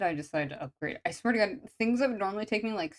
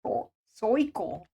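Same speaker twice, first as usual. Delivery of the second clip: a slow New York "circle" as "sɔɪkəl"; careful New York "circle"; New York accent; 2 semitones higher, slower than usual. Shown as a demonstration. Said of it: "That's how they would say it".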